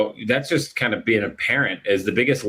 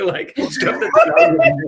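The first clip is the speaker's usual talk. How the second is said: high, louder, laughing through the words; helpless laughter; 5 dB louder than usual. Real, not acted. Dad tells of son blaming the dog, laughing.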